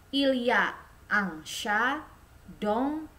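slow, careful French sentence with rising tune; teacher model reading. Shown as a demonstration. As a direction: spell it out slowly for learners. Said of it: "Itu perhatikan".

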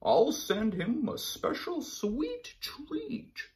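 high, slow sugary voice; sun bear, sweet. Acted. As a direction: savor the words. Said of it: "Sun Bear slurped".

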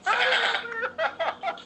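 high laughing squeal of disbelief; laughing cry. Real, not acted. Player bursting out laughing at the interview question, high laughing squeal.

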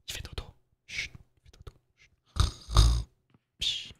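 very soft whisper with a shush; hushed whisper. Real, not acted. Streamer shushing for sleeping Megumi, hushed whisper. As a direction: whisper so as not to wake the sleeper. Hushing because Megumi is asleep.

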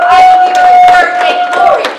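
very high, very loud, fast declaration; triumphant preacher's cry; ecstatic shout. Real, not acted. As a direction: shout it as the climax.

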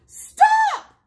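loud, high shouted command. A narrator's reading. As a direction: shout it out to halt a quarrel. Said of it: "frog shouted out".

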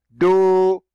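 "دو" as a loud, high, held "doo"; long vowel. Shown as a demonstration. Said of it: "صوت طويل ممدود". («دو» بصوت طويل ممدود وعال)

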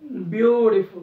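Beautiful slowed, BYU lifted; beautiful with the first syllable hit; stress on start. Shown as a demonstration. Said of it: "स्टार्टला उचल त्याला".

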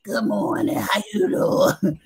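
mock hoarse, half-voiced greeting, slow and soft; a hint of a croaky, voiceless hello; voice shot from crack. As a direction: rasp it out like you've lost your voice. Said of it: "I ain't had no voice".

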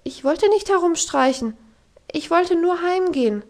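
put-on high, startled little girl; frightened. Heidi answering the scolding governess, high and frightened. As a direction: defend yourself in a scared, high voice. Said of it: "entgegnete Heidi erschrocken".

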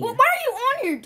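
high-pitched, startled question; alarmed. Acted. Friend catching the other on a website, alarmed. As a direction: demand it in a shocked squeal.

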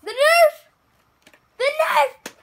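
high, loud thrilled yell; excited shout. Real, not acted. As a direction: shout it out with delight. Excited kid sees the Nerf.

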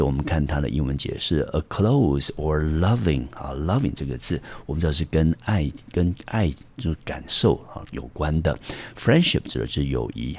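ordinary talk, nothing marked

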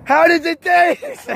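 high, slow singer impression; [name] voice. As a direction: stretch it out high like a crooner. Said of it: "my [name] voice".

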